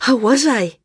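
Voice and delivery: high, loud, eager question; excited girl, voice lifted; breathless. A narrator's reading. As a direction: ask breathlessly, bursting to hear. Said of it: "she asked breathlessly".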